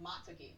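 "Matiki" with no R sound in the first syllable; correct name pronunciation. Shown as a demonstration. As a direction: say "Matiki" starting like "mah", then a t. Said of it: "my name's actually pronounced".

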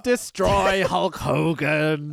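slow, drawn-out villain boast; a hint of a pompous wrestling villain; mock villain promo. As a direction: ham up the cartoon threat. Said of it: "quoting the villain promo".

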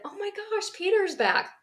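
high excited squeal; a girl thrilled he is back; mock excitement. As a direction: gush it out. Imagined excited household.

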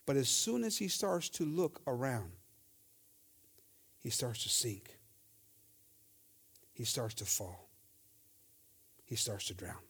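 grave preacher's hush with long pauses; hushed dramatic telling. A narrator's reading. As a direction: let each phrase drop away into a hush. Preacher dramatizes Peter starting to sink.

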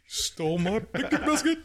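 Yogi Bear voice. Pretend. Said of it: "I love the Yogi Bear".

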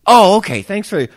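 high, loud mock gratitude; overly polite fake thanks; sarcastic. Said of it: "being sarcastic".

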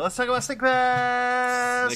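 high, loud, slow title call, last word held long; mock announcer shout. Podcaster having fun launching the Snake Pass segment, mock announcing. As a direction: belt it out and stretch "Pass".

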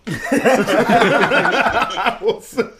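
high, very slowly stretched through laughter; laughing drawn-out repeat. Acted. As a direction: drag the threat out while cracking up. Repeats joke threat, laughing.

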